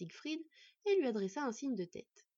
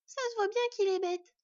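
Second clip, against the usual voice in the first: high, loud mock child whisper, fully voiced; high-pitched childish aside; little girl confiding; 11 semitones higher, 7 dB louder than usual. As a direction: confide cheekily in a small girl's voice. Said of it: "ajouta la petite aux yeux bleus en chuchotant".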